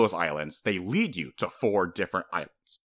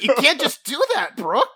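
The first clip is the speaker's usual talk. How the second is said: mock outrage; 7 dB louder than usual. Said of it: "And I'm just".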